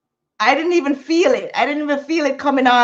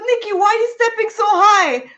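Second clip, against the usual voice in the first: higher teasing voice; tease, higher; friend's question; 10 semitones higher than usual. Retold or imitated.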